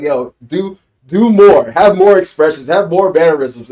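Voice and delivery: loud, pushing plea; urging the actor. Retold or imitated. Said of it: "I'm like".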